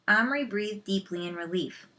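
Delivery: usual speaking voice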